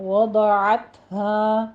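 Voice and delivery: وضعتها with a full, heavy ain; وضعتها drawn out slowly for the class; slow model recitation of وضعتها; tajweed pronunciation. Shown as a demonstration. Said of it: "پر پڑھنا ہے".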